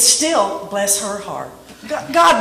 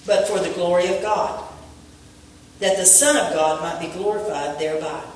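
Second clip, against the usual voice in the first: scripture reading; 4 semitones lower than usual. Acted. Preacher reading the Lazarus verse to the congregation, solemn. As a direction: read it like holy words, winding down.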